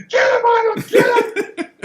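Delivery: very high-pitched egging-on yell; imitated frantic shout. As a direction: holler it like an excited onlooker at a brawl. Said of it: "I can still remember seeing Franco".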